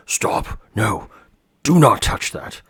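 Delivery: put-on sudden sharp yell to stop a child; sharp outburst. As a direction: snap out the warning, rising to a loud shout. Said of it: "I apologize for my outburst".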